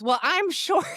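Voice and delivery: small high voice, lightly played; sheepish younger self. In acting class, a small high mock-timid voice. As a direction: pipe it up shyly. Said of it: "out of my mouth comes".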